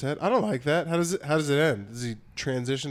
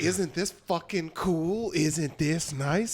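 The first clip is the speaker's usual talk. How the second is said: slowly drawn out, softer; slow smug gloat; 2 semitones higher, 3 dB quieter, much slower than usual. Retold or imitated. Kid pinning parents to wall, slow gloating. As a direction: savor each word like a smug villain. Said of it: "Being like".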